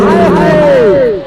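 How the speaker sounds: drawn-out loud slow cry; dramatic wail. Real, not acted. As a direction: stretch it out, loudly amazed. Commentator reacts to big play. (ਲੰਮੀ ਉੱਚੀ ਹਾਏ ਹਾਏ)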